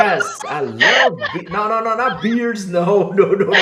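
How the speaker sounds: flustered, laughing no-no-no; laughing protest. Real, not acted. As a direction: protest while cracking up. Protesting the beard mishearing while laughing.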